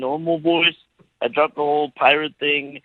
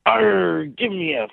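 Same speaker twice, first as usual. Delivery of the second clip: higher-pitched, hammed-up pirate; 6 semitones higher than usual. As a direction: growl out a playful pirate request. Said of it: "in a pirate voice".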